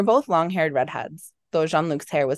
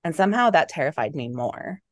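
plain reading voice

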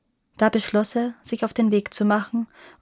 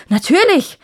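high, loud, emphatic agreement; emphatic royal assent, voice leaping up then falling; eager exclamation; 9 semitones higher, 8 dB louder than usual. A narrator's reading. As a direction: exclaim your consent at once. King eagerly agrees to urgent order.

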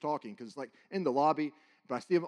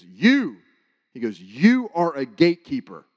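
booming declaration; 11 dB louder, slower than usual. Retold or imitated. Dream man declares to speaker.

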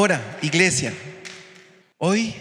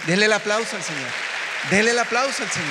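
cheering call; 5 semitones higher, faster than usual. Acted. Calling for applause.

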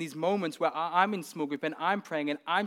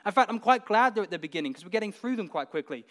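ordinary talk

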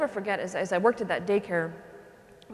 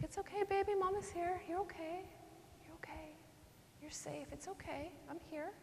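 high, very soft, hushed comforting; near-whispered reassurance to a crying child; motherly soothing; 9 semitones higher, 15 dB quieter than usual. Retold or imitated. Mother rocking her crying son.

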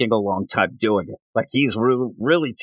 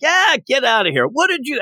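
mock henchman; 12 semitones higher than usual. Henchmen brushing her off.

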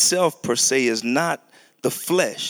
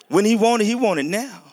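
higher, louder whiny demand; mock impatient toddler; 8 semitones higher, 3 dB louder, faster than usual. Preacher voicing his son Zion's impatience, higher and louder. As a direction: play the little boy who wants it now. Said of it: "My son Zion".

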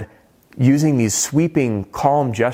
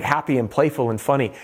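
ordinary talk

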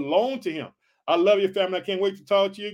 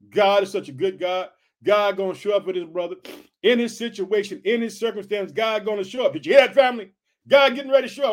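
ordinary talk

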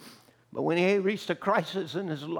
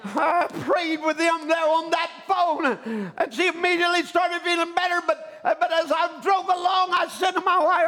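high, loud full-force sermon shout; shouted preaching; 8 semitones higher, 5 dB louder than usual. Real, not acted. Preacher recounting his prayer on the phone, impassioned shouting.

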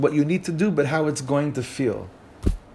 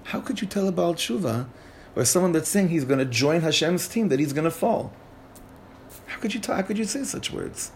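imitating appalled listeners, higher and hushed; mock scandalized, pitched up and mostly soft; freaked-out disbelief; 5 semitones higher, 3 dB quieter than usual. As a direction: voice their shock in a high, softened protest. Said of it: "freaked people out".

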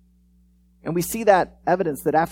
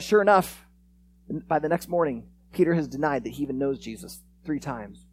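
ordinary talk

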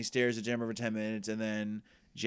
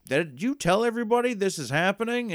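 loud, high mock demand; mock outrage at the news; mock outraged; 9 semitones higher, 5 dB louder than usual. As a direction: throw the question up high and loud. Club members confront Jax.

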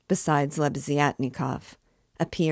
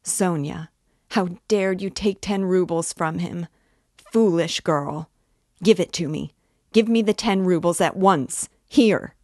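louder and higher to a shouted climax; frantic scolding shout; furious scolding; 3 semitones higher, 5 dB louder than usual. Acted. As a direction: order her to hand it over now. Katerina scolding, snatching the note.

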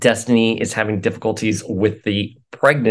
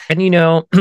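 words around a sip of coffee; sipping coffee; 8 semitones higher, 5 dB louder than usual. Real, not acted. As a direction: talk right after sipping a drink. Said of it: "sorry, I'm sipping my coffee".

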